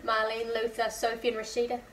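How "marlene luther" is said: usual speaking voice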